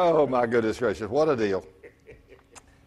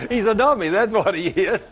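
laughing at himself, louder; laughing self-mockery; 5 semitones higher, 5 dB louder than usual. Real, not acted. Pastor mocking himself before the congregation, laughing. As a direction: call yourself a dummy through laughter. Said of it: "Don't speak that dummy voice".

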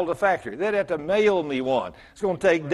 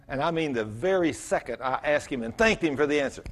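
usual speaking voice

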